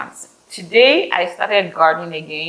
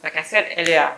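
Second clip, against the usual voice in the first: ordinary talk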